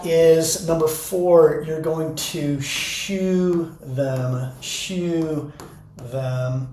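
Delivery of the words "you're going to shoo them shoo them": slow, much softer gentle shoo. Shown as a demonstration. Teacher shooing students gently back to seats, slow and soft. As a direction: ease them along softly, slowly. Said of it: "very calmly and kindly and gently".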